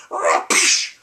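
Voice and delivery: loud crash sound, breathed out roughly; mock crash noise. Bullies in the hallway mocking his crash with a crash noise. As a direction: spit out a car-crash noise.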